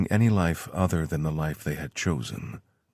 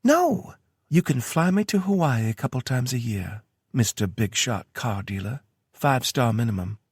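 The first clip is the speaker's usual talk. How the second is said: lightly higher woman's voice; Tara voice; 7 semitones higher than usual. A narrator's reading. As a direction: read her lines a bit higher. Tara's voice in story.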